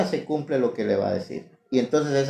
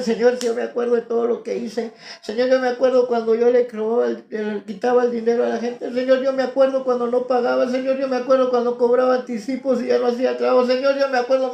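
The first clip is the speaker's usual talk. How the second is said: raised, pleading cry to the Lord; impassioned plea; 10 semitones higher, 4 dB louder than usual. Real, not acted. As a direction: beg the Lord, voice lifted high. Crying out confession to God.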